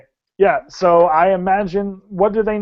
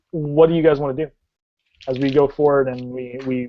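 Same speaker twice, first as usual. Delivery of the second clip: ordinary delivery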